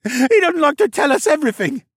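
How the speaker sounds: high, loud, sniveling protest; whining captive. A narrator's reading. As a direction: plead your case in a high whine. Sniveling captive keeps pleading.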